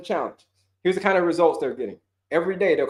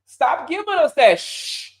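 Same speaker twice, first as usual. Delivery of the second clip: higher, slow, exasperated plea; the body pleading; 6 semitones higher, slower than usual. Pretend. Coach voicing the body scolding its owner, exasperated. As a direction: beg like a fed-up body. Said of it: "But your body's like".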